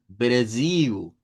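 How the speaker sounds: "Brasil" with the final L said as U, "Brasiu"; Portuguese "Brasil" with a U ending; Brazilian Brasil. Shown as a demonstration. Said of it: "con acento portugués de Brasil".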